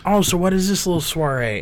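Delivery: hint of a prying party-crasher; nosy neighbor. Retelling the nosy neighbor's question.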